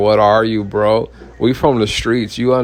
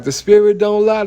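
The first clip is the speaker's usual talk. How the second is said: mock prophet; 9 semitones higher than usual. Guy claims spirit told him.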